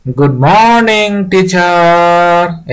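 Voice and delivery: high, very long drawn-out class chorus; stretched singsong pupils' greeting; students greeting teacher. Acted. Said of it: "শিক্ষার্থীবৃন্দ কি বলছে?".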